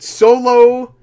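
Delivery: loud, high, drawn-out name; hammed-up title reveal; mock announcement. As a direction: proclaim it with exaggerated significance. Announcing the bad name moment.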